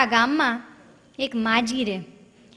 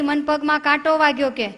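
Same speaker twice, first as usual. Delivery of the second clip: usual speaking voice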